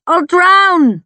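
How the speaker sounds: high-pitched, loud panicked cry; terrified shriek. A narrator's reading. Climber clinging above crashing waves, shrieking in terror. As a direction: cry out as if about to go under. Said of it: "I shriek".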